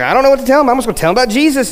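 fast, excited inner voice of Peter. Retold or imitated. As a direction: blurt it out, excited.

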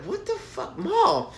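high disbelieving question to mom; stunned, pitch jumping up; baffled. Retold or imitated. Son staring at laughing mom, high baffled protest. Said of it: "staring at her like".

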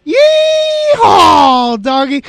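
high shouted Texas yeehaw, bad Texas impression; bad Texas accent. Picking Dallas with his worst Texas impression, yelling a Texan yeehaw. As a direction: hoot it like a bad Texan cowboy. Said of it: "doing my worst Texas impression".

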